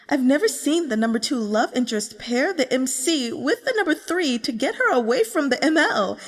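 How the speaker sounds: excited fan. Acted. Fan gushing over her favourite characters, excitedly. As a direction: rave about the characters like an eager reader.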